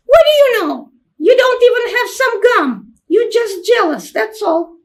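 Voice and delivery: put-on a kid sneering at her sister; little girl's voice. Little sister mocking the jealous older sister, high child voice. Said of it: "children's voice".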